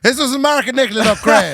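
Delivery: high, loud hammed-up announcement; mock wedding shout-out. Nervous reader hyping the newlyweds from the altar, mock announcing. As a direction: play at hyping the couple to a crowd.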